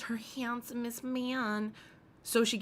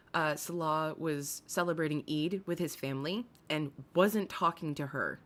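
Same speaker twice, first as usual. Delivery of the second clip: ordinary talk, nothing marked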